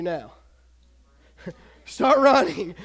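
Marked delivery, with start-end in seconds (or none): 1.9-2.7 loud, slow, decisive reply; raised, drawn-out answer; emphatic obvious answer. Acted. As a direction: belt it out as the obvious choice.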